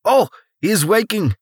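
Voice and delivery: put-on high, thick Russian accent, amused; a gleeful Russian man leaning over the patient; heavy Russian accent. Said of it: "in a heavy Russian accent".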